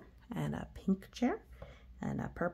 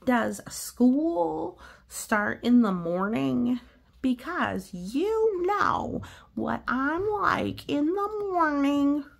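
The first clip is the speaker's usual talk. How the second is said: slow, higher whiny pigeon; 5 semitones higher, 5 dB louder, slower than usual. Acted. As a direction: drag it out like a grumpy bird.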